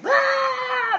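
high mock child's wail; hammed-up toddler tantrum shriek; tantrum scream. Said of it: "zero to sixty".

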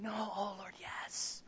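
soft unvoiced whisper. Preacher privately agreeing with God, a whisper. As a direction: breathe it under your breath.

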